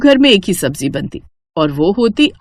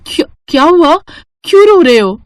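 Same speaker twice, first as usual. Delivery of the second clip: higher, louder worried mother; alarmed concern; 7 semitones higher, 4 dB louder than usual. Acted. Mother finds her son crying at night, alarmed concern. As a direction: ask urgently, alarmed for your child.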